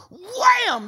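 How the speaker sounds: high, loud shout of wham. Acted. Preacher shouting wham to his congregation, loud. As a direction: slam the word home. Dramatic exclamation in story.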